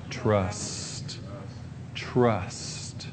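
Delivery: hushed whisper of one word. Real, not acted. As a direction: breathe the word Trust.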